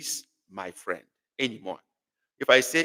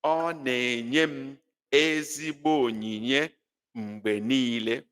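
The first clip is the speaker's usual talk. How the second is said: slow reading; slower than usual. Shown as a demonstration. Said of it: "let's go".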